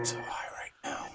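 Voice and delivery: whisper. Real, not acted. Stoned friend whispering to himself.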